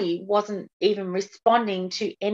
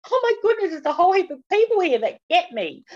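much higher, brighter rush of relief; overjoyed relief; 9 semitones higher, 3 dB louder than usual. Retold or imitated. New member discovering the forum, high overjoyed gush. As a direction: gush like someone who finally feels understood. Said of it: "It's like".

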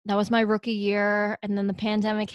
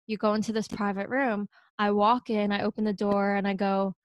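ordinary talk, nothing marked

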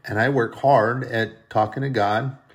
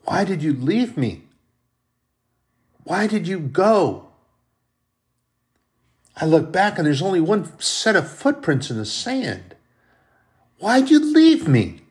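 high, louder anguished cry of why did you leave me; whining plea; 7 semitones higher, 4 dB louder than usual. Acted. Believer crying out to God in the parable, high anguished plea. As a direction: plead up to God, voice climbing. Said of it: "whining and crying to God".

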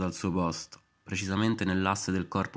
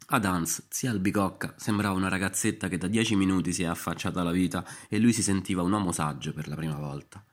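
plain reading voice

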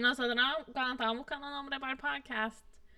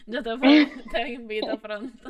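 talked through a laugh; laughing apology to the friend; 8 dB louder than usual. Real, not acted. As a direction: laugh while inviting her.

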